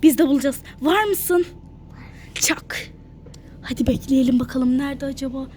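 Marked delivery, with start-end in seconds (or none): 2.3-2.9 whispered high five; whispering while hiding. Pretend. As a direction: breathe it out without voice. Playing hide and seek, hiding.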